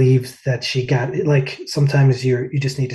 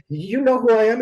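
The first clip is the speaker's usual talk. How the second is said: much higher, delighted disbelief; amazed; 10 semitones higher, 3 dB louder than usual. Retold or imitated. Singer asking [name] if he knows him, amazed high. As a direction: ask it like you can't believe it. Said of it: "I just remember going".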